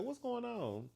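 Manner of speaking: high, puzzled sister voice; sister asking. Retold or imitated. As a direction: pitch it way up, light and confused. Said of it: "She said".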